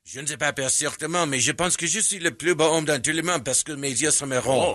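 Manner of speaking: fluent run of spoken French; speaking French. Shown as a demonstration. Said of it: "I'm actually speaking French".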